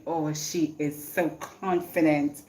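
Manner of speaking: ordinary talk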